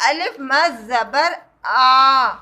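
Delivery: آ stretched slow and full; answering her own question slowly; drawn-out aa. Shown as a demonstration. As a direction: let آ ring out long. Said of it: "تو کس طرح سے آواز نکلے گی؟".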